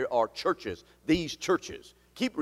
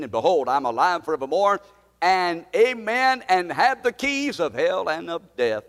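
preached scripture; 3 semitones higher, 5 dB louder than usual. Acted. Pastor proclaiming the risen Christ to his church, fervent. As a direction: build to a climax.

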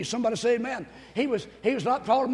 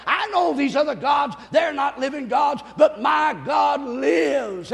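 impassioned preaching; 2 semitones higher, 4 dB louder than usual. Real, not acted. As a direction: hammer each declaration to the congregation. Preaching at full force.

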